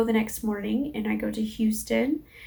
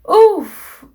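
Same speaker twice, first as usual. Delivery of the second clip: loud, high exhaled oof; stressed "oof"; 8 semitones higher, 12 dB louder than usual. Real, not acted. Reliving the stress of that day.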